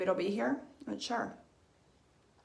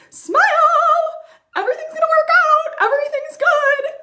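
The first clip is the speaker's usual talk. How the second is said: much higher and louder, forced brightness; mock cheerful; 10 dB louder than usual. To viewers, mocking people who hide pain, in a high loud fake-cheery voice. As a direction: chirp it out like a fake happy face.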